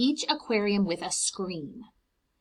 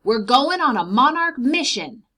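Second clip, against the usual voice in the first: loud, eager announcement; rally the dog for an adventure; excited call; 6 semitones higher, 10 dB louder than usual. Acted. Mister McGinty announcing the monarch mission to Sophie, excited and loud. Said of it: "he called".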